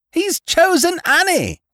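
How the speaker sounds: very high, loud, slow exclamation; girls' chorus cry. A narrator's reading. Girls announcing his choice together, high excited cry. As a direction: squeal the announcement out like a crowd. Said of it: "said the girls in chorus".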